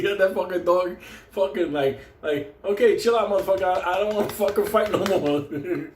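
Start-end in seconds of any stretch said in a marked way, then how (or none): 2.6-5.9 higher voice for a dog backing down; lightly voiced dog pleading for a truce; surrendering dog. Trapped dog gives up to the friend, mock surrender.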